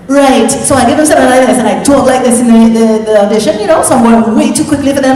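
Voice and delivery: put-on quick, thick Caribbean accent. Actor showing the accent she gave at her audition, quick Caribbean accent. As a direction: rattle it off in a Caribbean accent, slightly too fast. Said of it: "maybe a Caribbean?".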